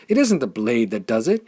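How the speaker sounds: high, loud joyful exclamation; elated loud cry; delighted cry. A narrator's reading. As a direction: shout it out in sheer delight. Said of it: "he cried in his delight".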